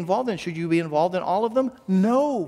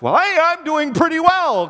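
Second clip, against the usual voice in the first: high, pleased-with-yourself mock voice; mock self-satisfied; 8 semitones higher than usual. Preacher voicing someone untested by living with others, mock self-satisfied. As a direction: act out breezy self-congratulation, pitched up.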